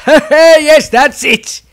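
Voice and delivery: high, loud burst of laughter through the words; laughing. Acted. Viez loses control laughing at the council. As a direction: crack up mid-line. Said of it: "laughed Viez".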